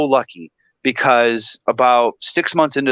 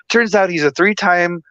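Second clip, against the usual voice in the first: normal talking voice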